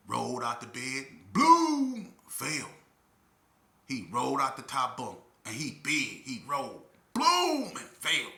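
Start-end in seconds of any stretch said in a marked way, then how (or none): 1.3-2.1 high, loud boom imitation; loud thud effect. Acting out the cellmate falling from the top bunk, loud boom. 7.1-7.7 high, loud boom imitation; loud thud effect. Acting out the cellmate falling from the top bunk, loud boom.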